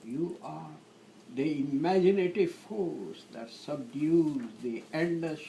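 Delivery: measured love-sonnet reading; poetry recitation. Acted. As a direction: recite in short phrases with long pauses.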